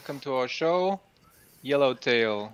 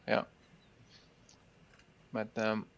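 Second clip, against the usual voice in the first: usual speaking voice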